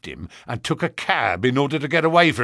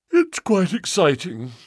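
said through a yawn; 6 semitones higher than usual. Acted. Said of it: "said Sherlock Holmes with a yawn".

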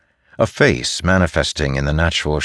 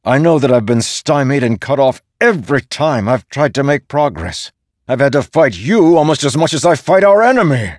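high, heated tirade growing louder; mounting anger; 9 semitones higher, 6 dB louder than usual. A narrator's reading. Dalinar venting about being thwarted, anger mounting. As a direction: build from frustration to open anger. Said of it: "feeling his anger mount".

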